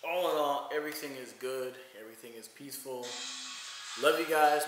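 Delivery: ordinary talk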